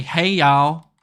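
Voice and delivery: loud, hammed-up American Southern twang; teasing US Southern accent; mock Southern accent. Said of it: "I'm gonna say".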